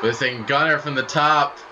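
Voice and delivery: usual speaking voice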